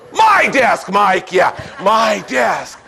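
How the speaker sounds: shouting my desk with a high pitch; proud shout of my desk; emphatic shout. Real, not acted. Claiming credit, emphatic.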